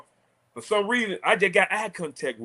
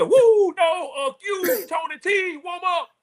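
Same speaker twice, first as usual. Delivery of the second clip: high laughing yell, half mocking; retold coach yell; 13 semitones higher, 3 dB louder, slower than usual. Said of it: "He said".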